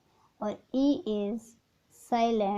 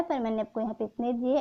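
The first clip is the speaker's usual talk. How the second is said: ordinary talk, nothing marked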